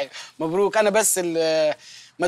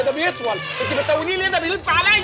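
mock panic; 10 semitones higher than usual. Husband pretending wife's pout attacks him, hammed-up shouting to doorman. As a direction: yell for rescue in fake terror.

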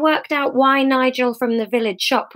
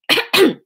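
throat clearing; 10 semitones higher, 4 dB louder than usual. Acted. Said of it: "coughed to get the crowd's attention".

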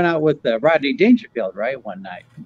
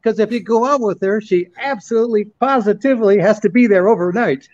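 higher, lightly imitated Rodney punchline; Rodney Dangerfield voice; 6 semitones higher than usual. Telling friends Rodney's FedEx joke in a higher light imitation. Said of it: "He goes".

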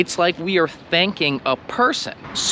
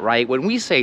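normal talking voice

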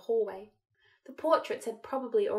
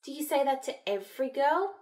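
higher, sugary sarcasm; sarcastically sweet; 5 semitones higher than usual. A narrator's reading. Hermione retorting to Malfoy's teasing, sarcastically sweet. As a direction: lift the pitch into mock sweetness. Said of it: "in a sarcastically sweet tone".

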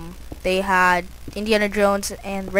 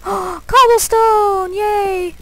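high, loud squealing cheer; screaming excitedly; 11 semitones higher, 5 dB louder, much slower than usual. Real, not acted. Gamer finding cobblestone, squealing high and loud with glee. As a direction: squeal it out with delight. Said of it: "I'm screaming like a girl".

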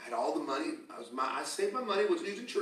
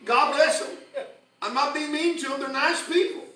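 higher, louder revival preaching; full force preaching; 8 semitones higher, 6 dB louder than usual. Acted. Pastor fires up the congregation, preaching louder and higher.

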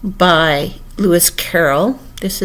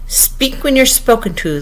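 lightly voiced sharp queen; sharp interruption; 6 semitones higher than usual. A narrator's reading. Red Queen cutting Alice off, sharply. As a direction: cut in sharply, pitch raised. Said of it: "the Queen sharply interrupted her".

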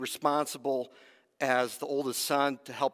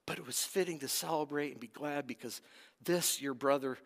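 much softer, half whispered; father gently pleading with angry son; tender appeal; 6 dB quieter than usual. Acted. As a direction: hush it like a loving father. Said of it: "He appeals to him".

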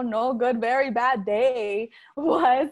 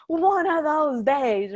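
drawn-out, louder exasperated sigh of words; mock exasperation; 4 semitones higher, 4 dB louder, much slower than usual. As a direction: stretch it out in fed-up disbelief. Venting after bread falls.

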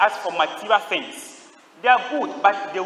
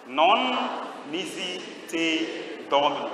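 Latin words drawn out one by one; slow intoned recitation; much slower than usual. Shown as a demonstration. Preacher gives the saint's Latin answer, slow intoned recitation. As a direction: stretch each word out slowly for the congregation. Asks them to repeat the words.

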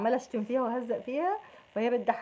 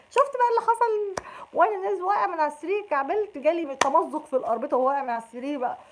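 much higher, whining storyteller voice; mock whiny excuse; 5 semitones higher, 5 dB louder than usual. Gemini spinning a late excuse, high mock whine. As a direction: spin a silly excuse with wide eyes.

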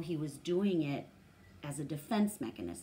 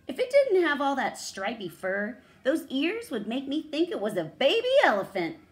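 loud, high taunting dog voice; mocking dog; 9 semitones higher, 10 dB louder than usual. Lead dog taunting little Pinduli about her ears, loud high jeering.

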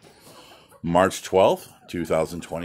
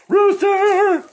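much higher, louder shout of rooster; calling out; 9 dB louder than usual. Real, not acted. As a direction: yell it out across the yard.

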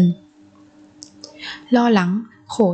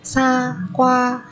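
사과하다 with a pause after each syllable; 4 semitones higher than usual. Shown as a demonstration. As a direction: separate every syllable of 사과하다 for learners. Teacher models word syllable by syllable.

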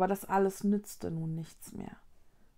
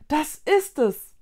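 loud, higher burst of recognition; blurted out; 7 semitones higher, 7 dB louder, slower than usual. A narrator's reading. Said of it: "entfuhr es Konrad".

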